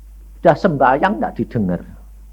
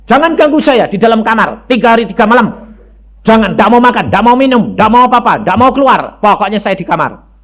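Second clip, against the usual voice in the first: stubborn declaration; 8 semitones higher, 6 dB louder than usual. Retold or imitated. Declaring fast to family.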